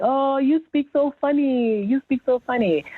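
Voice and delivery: high sing-out mock teasing; teasing cousins. Cousins poking fun at her daughter's accent, high mock teasing. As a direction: imitate kids teasing, pitched well up. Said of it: "she saw my cousins like".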